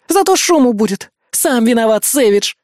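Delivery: high, vindictive parting shot; Bismarck's angry growl. A narrator's reading. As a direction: throw the last word at him angrily. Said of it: "прорычала Бисмарк и удалилась в дом".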